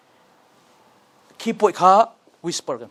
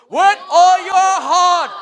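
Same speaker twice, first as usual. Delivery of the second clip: high, loud and slow; joyful shout; 11 semitones higher, 9 dB louder, slower than usual. Shown as a demonstration. Teacher leading class reading, joyful shout. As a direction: shout it out with joy. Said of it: "And then shout joyful voice".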